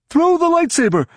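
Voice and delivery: very high-pitched command; high, straining character shout; urgent shout. A narrator's reading. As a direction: yell the instruction over the fight. Shouted order mid-battle.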